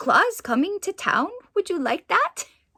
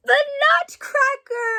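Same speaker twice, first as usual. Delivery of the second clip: gasp, then a much higher cry of recognition; thrilled to spot the Nutcracker; a high, delighted exclamation; excited gasp; 13 semitones higher, 4 dB louder than usual. Real, not acted.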